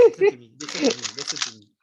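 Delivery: laughing insistence. Real, not acted. Teacher demanding attention from the class, laughing and loud. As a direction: insist they listen while still laughing.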